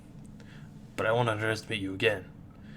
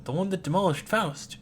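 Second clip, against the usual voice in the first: ordinary talk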